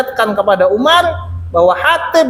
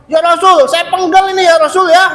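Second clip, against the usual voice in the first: higher, louder furious offer; angry Umar; 8 semitones higher, 3 dB louder than usual. Retold or imitated. Umar offers the Prophet to behead the creditor, angrily. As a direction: burst out ready to strike. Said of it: "menampakkan kemarahannya adalah Umar".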